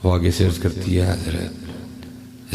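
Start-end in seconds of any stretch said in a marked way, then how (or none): 1.1-2.6 timid whisper. Acted. Maid answers the king.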